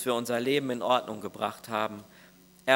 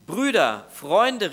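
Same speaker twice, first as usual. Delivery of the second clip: a touch higher and louder, calling for help; 6 semitones higher, 6 dB louder, much slower than usual. Retold or imitated. The man calls his brothers to help him clean up, mild call. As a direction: call your friends over, retold. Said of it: "rief er".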